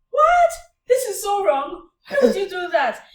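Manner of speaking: loud appalled outcry; shocked exclamation. Retold or imitated. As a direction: burst out in horror at the wrong done.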